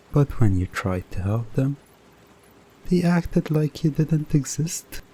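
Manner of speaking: ordinary delivery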